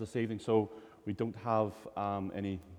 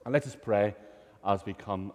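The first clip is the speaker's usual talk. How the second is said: ordinary talk, nothing marked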